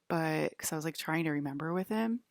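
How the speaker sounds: ordinary talk, nothing marked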